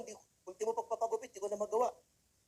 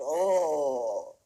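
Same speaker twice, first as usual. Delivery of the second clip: Japanese captain "Oh"; 5 semitones lower, 5 dB louder than usual. Retold or imitated. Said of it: "Ganun sila makapagsalita".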